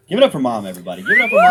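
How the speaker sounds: loud crowd-rallying call, building to a shout; hype-man shout; calling for applause. Real, not acted. Comic rallies crowd to applaud Mom.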